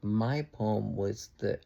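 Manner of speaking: ordinary talk, nothing marked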